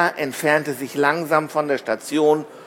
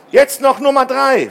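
high, loud and pleased; happy, raised-pitch announcement; delighted; 10 semitones higher, 5 dB louder than usual. A narrator's reading. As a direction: cheer on the last stretch. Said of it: "freute sich Tom".

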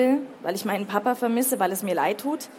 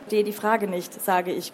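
plain reading voice

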